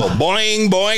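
high, springy "boing"; imitated bouncing noise. Imitating child bouncing on couch.

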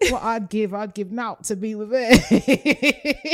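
high, loud, slow put-on Geordie, laughing; a cheeky Geordie lass swooning over a lad; mock Geordie accent. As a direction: ham up a north-east English accent, giggling. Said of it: "we have to do that accent way more".

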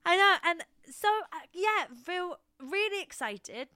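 high, loud and thrilled; excited. Real, not acted. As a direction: burst with excitement. Excited reply.

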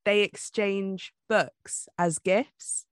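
ordinary talk

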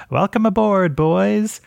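high-pitched, cocky in-character call; cocky shout. Acted. Zelf to soldiers climbing aboard, cocky high-pitched shout. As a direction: call it out cockily, pitched well up. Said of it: "so he shouts something like".

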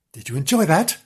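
usual speaking voice

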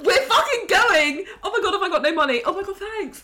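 high laughing switch panic to thanks; hammed-up flustered gratitude; mock gear change. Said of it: "imitating the gear change".

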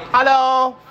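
high, loud greeting; loud high hello. Real, not acted. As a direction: belt out a hello. Judge's brief greeting.

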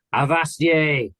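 high, drawn-out play-pirate cry; pirate yell. A narrator's reading. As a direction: yell it out slowly like a boy playing pirates.

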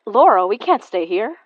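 high, loud panicked protest; scared and urgent. Acted. Panic at haunting voice.

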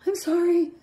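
small high-pitched scared apology; sheepish, scared. Acted. Someone who dropped the couch, sheepish and scared. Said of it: "act all sheepish or something".

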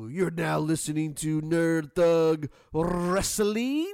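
wrestling with a rolled R, drawn out. Acted. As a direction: roll the R in wrestling, announcer style. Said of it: "Ooh, you rolled the R".

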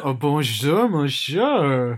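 loud, hammed-up French accent; mock French accent. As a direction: put on an exaggerated French accent, loud and lilting. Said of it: "do the accents quite well".

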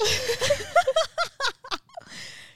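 long, high burst of laughter. Real, not acted. Host laughs at her own texting, high laugh. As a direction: crack up high.

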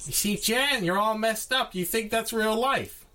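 high, strained put-on voice; a hammed-up Tarantino voice; Tarantino imitation. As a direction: mimic the director mockingly.

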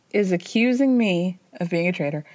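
ordinary delivery

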